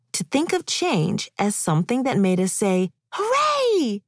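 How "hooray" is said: bright, high happy cheer; excited cheer. Pretend. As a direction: cheer it like great news. Imagining a happy reaction to change.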